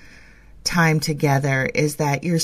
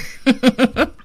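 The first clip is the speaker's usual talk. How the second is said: a bright, high burst of laughter; short high laugh; real laugh; 8 semitones higher, 4 dB louder than usual. Real, not acted. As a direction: laugh at your own joke. Laughs after joke.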